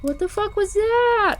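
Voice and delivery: high startled cry of alarm. Retold or imitated. Alone at night hearing knocks, high startled exclamation. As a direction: shoot up in pitch, spooked.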